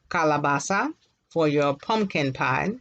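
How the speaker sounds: ordinary talk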